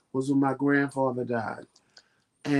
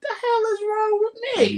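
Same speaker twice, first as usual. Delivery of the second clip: much higher, baffled self-scolding; exasperated at herself. Retold or imitated. Crying over Harry Potter, squeaking in exasperation. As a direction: squeak it in disbelief at yourself. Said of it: "I'm like".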